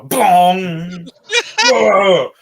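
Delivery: loud high bong and whoa; hammed-up cartoon bonk; cartoon bonk and cry as the orc walks into a pillar. Cartoon collision.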